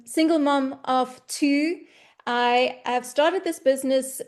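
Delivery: ordinary talk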